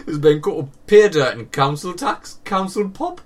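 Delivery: laughing through the words; cracking up mid-sentence. Real, not acted.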